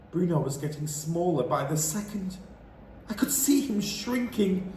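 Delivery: ordinary delivery, nothing marked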